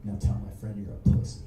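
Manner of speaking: quick, very low acted order; low menace. Dave still grips the skinhead, giving a low menacing order. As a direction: act out a controlled threat, pitched right down.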